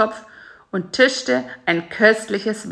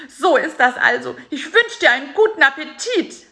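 bitter high fly voice; 10 semitones higher than usual. A narrator's reading. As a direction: throw his betrayal back at him. Bisi's bitter accusation.

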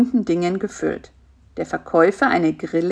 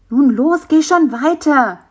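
higher, louder nudge forward; encouraging; 6 semitones higher, 5 dB louder than usual. A narrator's reading. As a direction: urge the spider on cheerfully. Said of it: "ermunterte ihn Bisi".

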